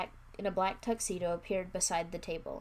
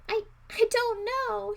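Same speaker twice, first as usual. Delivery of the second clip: higher, slow tearful answer; boy sobbing to his sister; 7 dB louder, slower than usual. A narrator's reading. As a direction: choke the words through sobs. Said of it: "he sobbed".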